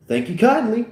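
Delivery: high, sugary gratitude; lightly hammed-up grateful cashier; fantasy cashier. Fantasy cashier thanks customer.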